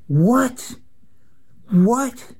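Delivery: sudden high, stunned "What? What?"; incredulous what. Real, not acted. Reacting to Biden's stance, high incredulous cry.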